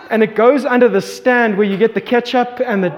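ordinary talk, nothing marked

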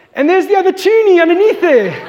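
can't believe it, laugh; laughing disbelief. Real, not acted. Laughing disbelief at lost coin.

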